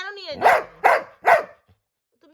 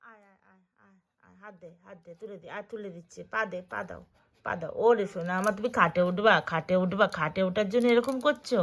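low, much softer coaxing of the pet; 9 semitones lower, 6 dB quieter than usual. Real, not acted. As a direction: gently coax the pet up onto the bed. Coaxing the pet onto bed.

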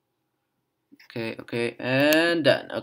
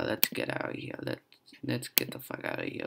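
whisper; 12 dB quieter, faster than usual. Real, not acted. Whispering while sneaking off.

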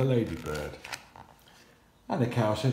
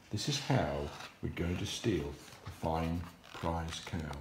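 softer, hushed conspiratorial plotting; thieves whispering; 7 semitones lower, 8 dB quieter than usual. Acted. Thieves plotting to steal the cow, hushed. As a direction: lean in and murmur the plan low. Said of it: "She heard them whisper".

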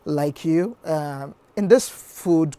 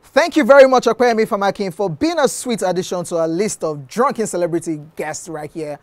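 announcer voice; 3 semitones higher, 7 dB louder than usual. Acted. Host announcing the end of the session to viewers. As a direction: wrap up the show like a TV host.

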